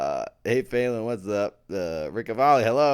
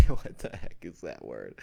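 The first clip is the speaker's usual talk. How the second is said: laughing; 8 semitones lower, 11 dB louder than usual. Real, not acted. Laughing fit on stream.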